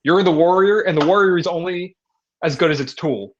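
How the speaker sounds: loud, high rousing pep talk; imitating a friend's big locker-room speech. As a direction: fire up your buddy like a coach. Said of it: "this really long pep talk".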